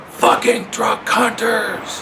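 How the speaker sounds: high, slow, drawn-out angry yell; furious yell. Retold or imitated. Leaning from the driver's seat, yelling at the hunters, furious. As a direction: yell it out the window at them. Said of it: "yells just as loud as he can".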